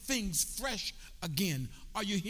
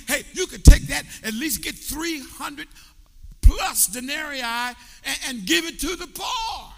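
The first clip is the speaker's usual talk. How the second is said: imitating a scoffing disciple; 5 semitones higher, 13 dB louder than usual. Disciple voiced in sermon.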